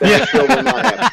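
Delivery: high, loud blurt over laughter; loud exclamation. Real, not acted. Racegoer ruling out a clashing date, high loud exclamation.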